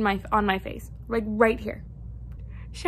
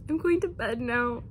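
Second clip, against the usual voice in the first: ordinary talk, nothing marked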